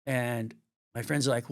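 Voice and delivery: ordinary talk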